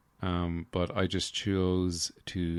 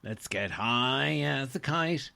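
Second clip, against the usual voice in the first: stoner voice; 5 semitones higher than usual. Retold or imitated. Telling viewers how stoners talk, in a higher dopey stoner voice. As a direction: play the dopey pothead inviting friends. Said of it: "but not in that accent".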